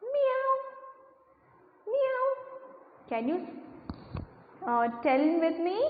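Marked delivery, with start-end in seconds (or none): 0.0-2.2 put-on high squeaky meow for the kids; cat meow. Shown as a demonstration. Teacher shows the class a cat's meow. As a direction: mew like a kitten, pitched way up. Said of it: "How it sounds?".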